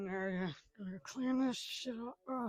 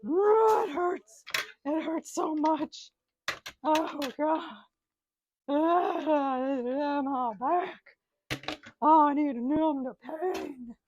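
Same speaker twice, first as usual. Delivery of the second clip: higher, louder mock-anguished crying; hammed-up wailing; 7 semitones higher, 8 dB louder, much slower than usual. Man at the sink wails for his back pills, hammed-up crying. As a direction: overact the back pain with wails.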